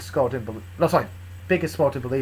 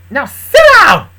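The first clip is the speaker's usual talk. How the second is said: high, very loud command; shouted order; 13 semitones higher, 15 dB louder, slower than usual. Acted. Luna ordering Big and Small down, shouting. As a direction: yell it, exploding on the last words.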